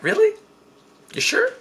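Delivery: very high, doubtful questioning; squeaky mock disbelief; skeptical. Friends questioning his sleeping plan, high and doubtful.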